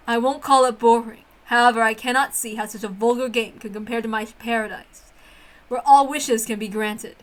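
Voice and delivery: low, haughty witch voice disdaining human games; a proud witch sneering at childish fun; scornful witch. Acted. Witch Beatrice sneering at human children's games, low and haughty.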